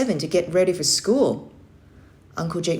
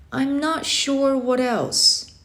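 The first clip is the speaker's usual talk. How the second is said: put-on unsure boy; 9 semitones higher, much slower than usual. Boy voiced by narrator, unsure.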